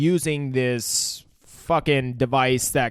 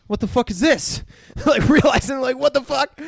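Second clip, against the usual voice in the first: mock disbelief; 10 semitones higher, 4 dB louder than usual. Acting out douchebag's shocked reaction.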